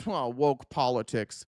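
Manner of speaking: low, slow, dismissive mock of Rogan; slow, low sneer at Rogan's takeaway; mocking Joe Rogan. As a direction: drop low and drawl it out like a dim guy. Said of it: "what he took from that is".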